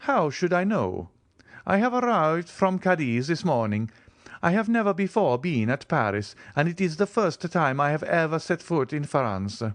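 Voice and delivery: the Count, lightly played, unconcerned; lightly voiced Count. A narrator's reading.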